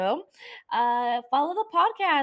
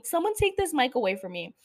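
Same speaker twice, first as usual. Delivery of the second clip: ordinary delivery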